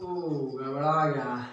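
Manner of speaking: oops, under breath. Real, not acted. Teacher notices own mistake.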